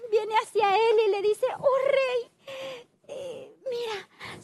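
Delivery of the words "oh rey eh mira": high, crying plea trailing soft and slow; tearful plea. Acted. Servant to king, begging through tears. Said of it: "suplicándole".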